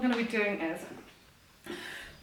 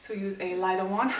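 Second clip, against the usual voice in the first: ordinary talk, nothing marked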